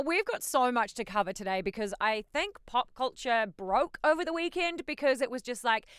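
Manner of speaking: ordinary talk, nothing marked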